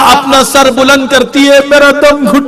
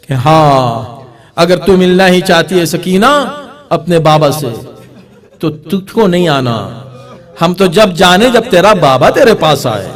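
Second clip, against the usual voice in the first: lower, taunting voice of a scornful ruler; mocking tyrant; 7 semitones lower, 3 dB quieter than usual. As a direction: sneer it out like a cruel king. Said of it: "ہنس کے کہتا ہے یزید".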